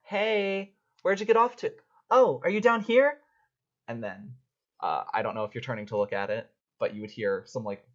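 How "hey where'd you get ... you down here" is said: much higher, louder cheery call; sunshine and rainbows. Acted. Newcomer calling cheerily down the alley. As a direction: sound like pure sunshine. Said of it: "a voice that literally sounds like sunshine and rainbows".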